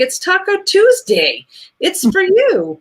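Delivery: put-on high, loud, eager kid voice; little boy's voice. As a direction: play the excited little student handing over a gift. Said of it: "And he's like".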